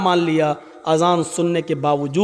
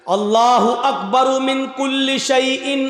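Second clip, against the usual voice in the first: slow, measured intoned recitation; 6 semitones higher, slower than usual. Shown as a demonstration. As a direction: draw "अल्लाहु अकबर मिन कुल्ली शैइन" out carefully. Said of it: "बहुत slow बोल रहा हूं". (धीमे-धीमे, ठहरकर)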